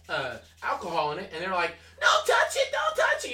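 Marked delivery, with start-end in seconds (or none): 2.0-3.3 very high, loud mock child shriek; panicked child imitation. Child protesting alcohol on a scrape, hammed-up panicked shriek. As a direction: squeal like a kid guarding a sore spot.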